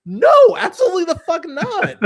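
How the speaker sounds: high, loud laughing shout of refusal; emphatic refusal. Retold or imitated. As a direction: yell it out, laughing in disbelief. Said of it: "And I'm like".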